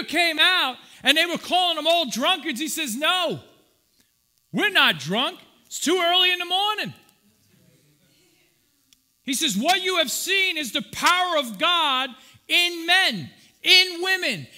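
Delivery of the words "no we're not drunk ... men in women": loud, high preacher's proclamation; proclaiming. Retold or imitated. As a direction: proclaim it to the crowd. Peter voiced proclaiming to crowd.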